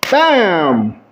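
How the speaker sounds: sudden punchy exclamation; abrupt burst out of a sad hush; attention-grabbing bang. Shown as a demonstration. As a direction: jolt the listeners awake. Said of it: "Did that get your attention?".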